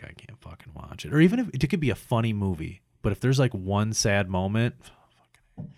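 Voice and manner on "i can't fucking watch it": voiceless, defeated whisper; whispered give-up. Retold or imitated. At a sad movie scene, whispered surrender. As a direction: murmur it like you can't bear the scene.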